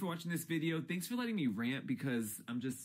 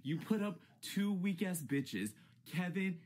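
usual speaking voice

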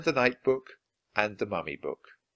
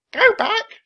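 very high, loud bird voice; parrot squawk; 12 dB louder than usual. A narrator's reading. Parrot warns the pirates, squawking high and loud. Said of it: "she squawked".

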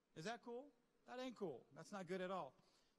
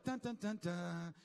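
mock dramatic dun dun; 4 dB louder, slower than usual. Dramatic sound effect.

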